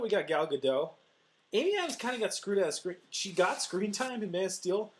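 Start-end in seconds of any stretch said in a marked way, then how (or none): none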